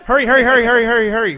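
loud, rapid-fire repeated call; carnival barker patter. Pretend. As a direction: hustle the crowd along loudly. Barker drumming up a giveaway.

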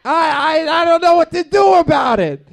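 high, loud, fast indignant rant; worked-up shout. Retold or imitated. Complaining to Kevin about the new Chipotle, shouting. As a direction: rant like the old bar is gone. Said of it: "But I says".

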